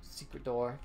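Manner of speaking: usual speaking voice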